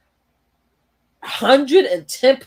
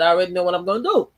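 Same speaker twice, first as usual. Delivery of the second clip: normal talking voice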